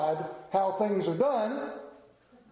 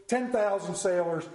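ordinary talk

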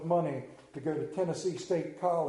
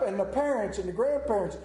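normal talking voice

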